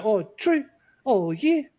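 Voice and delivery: high-pitched mimicry of a woman wrestler; exaggerated impersonation, pitched well up; Dakota Kai. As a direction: put on a high caricature voice. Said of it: "your Dakota Kai impersonation".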